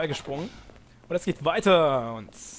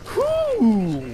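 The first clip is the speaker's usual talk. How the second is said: relieved puff; 7 dB louder than usual. Real, not acted. Relief after escape.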